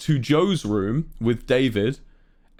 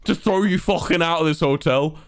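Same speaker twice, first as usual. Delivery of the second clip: higher-pitched Scouse threat, imitated; Scouse deskman; 5 semitones higher than usual. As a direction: warn them as an annoyed Scouse concierge. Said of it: "and he goes".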